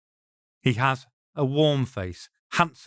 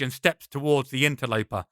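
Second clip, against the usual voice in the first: plain reading voice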